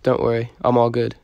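ordinary talk, nothing marked

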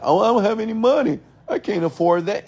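high, mocking whine of an excuse-maker; mock whining. Mocking the fearful listener.

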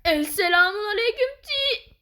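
high, loud call; loud greeting shout. Real, not acted. Gamer shouting a greeting at the match. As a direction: call it out across the room.

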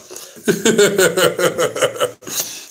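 real rolling laughter; hearty amused laugh. Real, not acted. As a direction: crack up at an absurd idea. Scoffs at Canelo in Cleveland.

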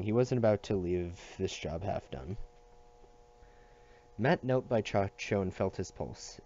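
ordinary delivery

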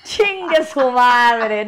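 loud, very slow, delighted showing-off; gleeful boast, drawn out and loud; happy sister. Retold or imitated. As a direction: show off the find, laughing. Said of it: "y dijo".

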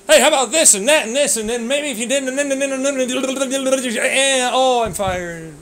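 loud high hammed-up babble, slowing; mock rambling boss. Mocking a rambling boss, loud high hammed-up babble. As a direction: parody a boss who rambles.

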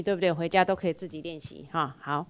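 ordinary delivery

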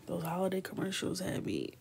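ordinary delivery

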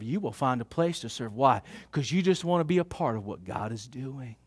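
hushed heartfelt preaching; soft preaching. Acted. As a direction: draw them in gently. Soft, low preaching passage.

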